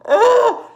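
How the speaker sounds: a sudden high, loud shout of release; loud frustrated yell. Real, not acted. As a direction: blow off steam with one yell. Sudden outburst after rant.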